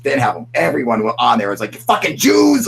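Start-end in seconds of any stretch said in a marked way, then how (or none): 1.8-2.7 high, loud imitation of ranting posters; mock hateful yell. Streamer imitating extremist posters to his viewers, mock yell. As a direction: mimic an angry shout, pitched way up.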